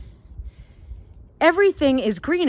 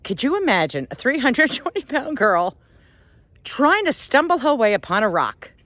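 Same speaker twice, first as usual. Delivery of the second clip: ordinary talk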